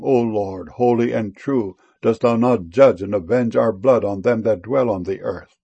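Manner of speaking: normal talking voice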